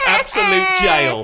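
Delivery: high, loud, slow crowing; gloating. Acted. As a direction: draw out the triumph gleefully. Gloating gotcha.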